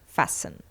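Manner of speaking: "Fasten" whispered as "fass-en". Shown as a demonstration. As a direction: breathe out "Fasten" with a silent T. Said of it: "but we do not pronounce T".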